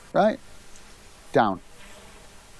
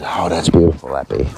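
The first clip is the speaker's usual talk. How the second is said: tender praise; 10 semitones lower than usual. Real, not acted. Praising his dog after a trick.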